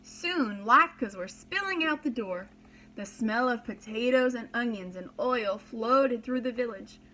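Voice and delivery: plain reading voice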